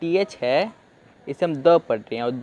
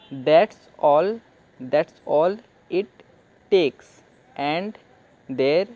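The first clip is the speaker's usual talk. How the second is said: slow reading aloud; much slower than usual. Shown as a demonstration. Teacher reading the English sentence slowly to students. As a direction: read each English word slowly, with pauses. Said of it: "तो ऐसे आप पढ़ सकते हैं".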